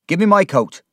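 loud, high, angry command; forceful order. A narrator's reading. Mister Peggotty resolves to leave at once, angry order. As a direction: order it urgently and loudly. Peggotty sets off after Emily.

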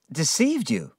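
taken aback, voice lifted; surprised. Acted. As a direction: echo it back in disbelief. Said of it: "I asked in surprise".

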